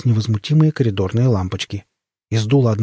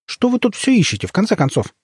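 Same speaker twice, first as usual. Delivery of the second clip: exasperated question; 10 semitones higher than usual. A narrator's reading. Exasperated question to rummaging intruder.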